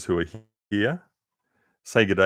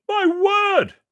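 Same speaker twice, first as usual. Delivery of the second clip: very high, loud amazed crowd; the crowd marvels at the elephant bird; excited shouting; 9 dB louder than usual. Acted. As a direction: rave about the new creature. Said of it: "they shouted".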